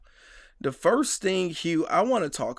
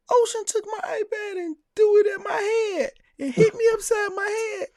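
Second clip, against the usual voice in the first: high, loud sobbing kid voice, imitating; crying little boy; 4 dB louder than usual. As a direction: mimic a wailing five-year-old, shouting through tears. Son crying about sister's attack.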